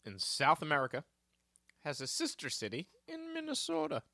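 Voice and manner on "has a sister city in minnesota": high, put-on Irish slash Minnesota lilt on "Minnesota"; mock Irish Minnesota quiz voice; Irish Minnesota accent. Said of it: "That's my Irish slash Minnesota accent".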